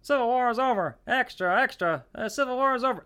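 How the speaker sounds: put-on high kid's voice crying the headline; newsboy call. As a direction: shout the news like a young paperboy. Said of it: "He says".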